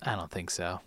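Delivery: low, quiet brush-off; cool dismissal. Retold or imitated. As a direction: play it cool, barely raising your voice. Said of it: "And he's like".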